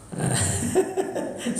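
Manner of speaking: real laughter at a police story; breathy laugh. Real, not acted. Laughs at own joke.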